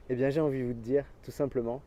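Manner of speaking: normal talking voice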